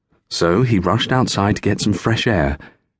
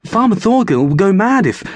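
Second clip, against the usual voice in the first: much higher and louder, cut off mid-word; panicked protest; 12 semitones higher, 4 dB louder than usual. Acted. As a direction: blurt it out in alarm. Frightened servant protesting.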